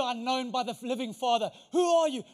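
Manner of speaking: usual speaking voice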